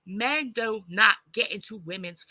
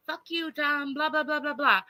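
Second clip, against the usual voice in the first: higher, lightly acted scolding woman; mock angry woman; 8 semitones higher than usual. Imitating a woman cussing out Dom, mock angry. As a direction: toss off a mock tirade.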